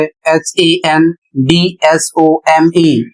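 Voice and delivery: letters spelled slowly one by one; slow spelling out. Shown as a demonstration. Teacher spelling handsome slowly for the students. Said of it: "spelling out the word".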